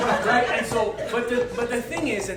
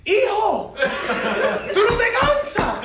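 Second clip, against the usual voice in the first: put-on high, teasing old man's call; old Cuban man calling out, amused; 11 semitones higher, 5 dB louder, slower than usual. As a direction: play the old Cuban guy ribbing him. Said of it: "and he's telling me".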